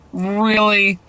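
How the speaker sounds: drawn-out "Really", moderately high; incredulous. Acted. Second of three descending reactions.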